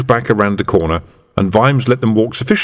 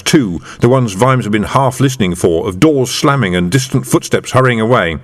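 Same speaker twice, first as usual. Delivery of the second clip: ordinary delivery, nothing marked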